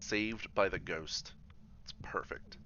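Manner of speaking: drawn out slowly, savoring each word; slow, savoring relief. Real, not acted. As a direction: let the line stretch out in slow relief. Reacts to ghost saving him.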